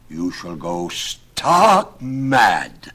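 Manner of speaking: very slow, drawn-out threat building loud; dramatic doom-laden warning; ominous warning. Acted. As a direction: pronounce a dire curse, stretching the words. Nicholas threatens a dire penalty.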